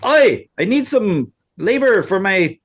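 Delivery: high, slow, put-on Mexican accent. Truck driver hailing day labourers for his project, mock Mexican accent. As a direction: call out in a hammed-up Mexican accent. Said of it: "he has a Mexican accent".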